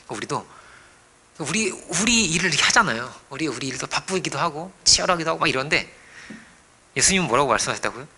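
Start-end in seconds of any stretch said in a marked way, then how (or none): none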